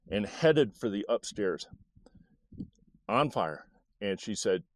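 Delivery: ordinary delivery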